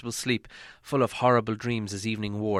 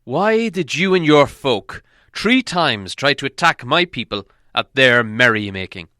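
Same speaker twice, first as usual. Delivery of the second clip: stern elf king; 3 semitones higher, 9 dB louder than usual. A narrator's reading. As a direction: question him like a stern king demanding answers. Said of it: "asked the king".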